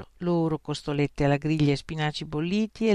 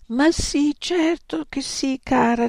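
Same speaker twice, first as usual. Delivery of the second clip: through tears; 10 semitones higher, 3 dB louder, slower than usual. A narrator's reading. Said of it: "rispose tra le lacrime Arrow".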